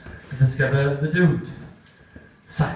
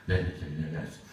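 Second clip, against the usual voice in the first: meek yes; 10 semitones lower, 10 dB quieter than usual. Acted. Kevin meekly submitting.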